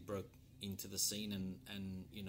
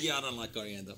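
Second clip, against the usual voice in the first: laughing; 7 dB louder, faster than usual. Real, not acted. Still laughing about coriander.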